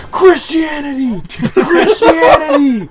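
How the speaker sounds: slow, high stretched chant, laughing at the end; cheering yell. Retold or imitated. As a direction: belt it like a wild fan in the stands. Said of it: "and I started yelling".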